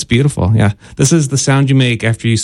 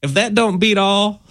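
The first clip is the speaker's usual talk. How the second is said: put-on southern accent; 7 semitones higher, 3 dB quieter than usual. Co-host slipping into southern talk: southern accent. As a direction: play the southern lady tut-tutting in a southern accent. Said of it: "Why is your voice getting southern sounding".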